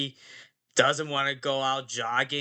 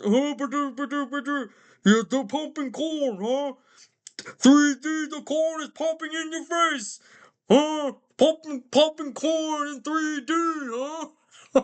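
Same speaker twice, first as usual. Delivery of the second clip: high, sing-song mock-Swedish babble; goofy high-pitched cook impression; Swedish Chef; 11 semitones higher than usual. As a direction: bubble along like an excitable cartoon cook. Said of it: "Swedish Chef also was in this movie".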